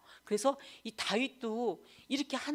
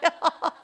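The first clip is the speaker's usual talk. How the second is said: short bright burst of laughter; amused laugh; 7 semitones higher, 9 dB louder than usual. Real, not acted. Wife laughing at her husband's question, short burst.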